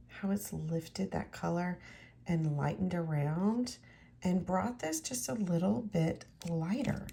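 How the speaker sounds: ordinary talk, nothing marked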